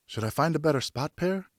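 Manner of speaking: a nervous youngster asking his father; lightly voiced boy. A narrator's reading.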